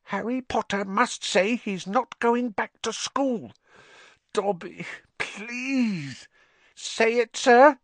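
high, pleading elf voice; squeaky house-elf. A narrator's reading. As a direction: beg like a timid little elf.

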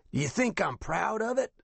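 high, scratchy, affronted veteran; offended captain. A narrator's reading. Captain rebukes the laughing investigator, offended raspy voice. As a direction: challenge him in a high raspy voice.

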